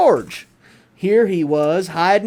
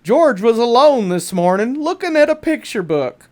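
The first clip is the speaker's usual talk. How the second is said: ordinary delivery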